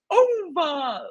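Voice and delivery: a high, cracking sung-out over; mock voice crack. Ring announcer mocking the rookie, high cracking over. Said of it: "and he goes".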